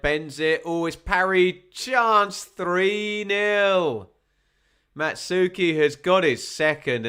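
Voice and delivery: rising to a high, loud goal shout; excited goal call. Real, not acted. Goal scored, live call.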